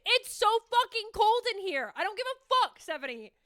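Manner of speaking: high, squealed protest; freezing brain's outburst. Acted. Her brain reacting to the cold shower, squealed outburst. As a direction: squeal it like icy water just hit you. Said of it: "That's what my brain says".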